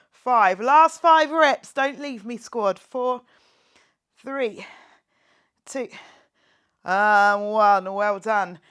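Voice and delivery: rally the squad to finish; full-voiced workout coach shouting the count. Real, not acted. Coach pushing last five reps.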